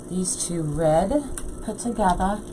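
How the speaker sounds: usual speaking voice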